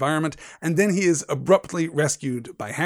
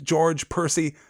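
normal talking voice

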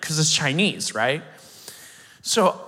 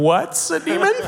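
much higher, bewildered disbelief; stunned question; 13 semitones higher, 3 dB louder than usual. Retold or imitated. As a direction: ask it like you can't believe it. Baffled at translator's answer.

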